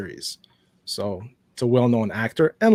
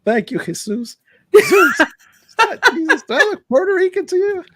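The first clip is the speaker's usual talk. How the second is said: much higher, louder movie-quote impression, full of outrage; Samuel L. Jackson; 8 dB louder than usual. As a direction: throw the line out like the Die Hard Three scene. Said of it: "Die Hard Three with Samuel L. Jackson".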